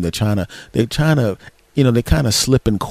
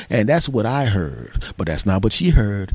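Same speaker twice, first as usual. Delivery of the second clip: ordinary talk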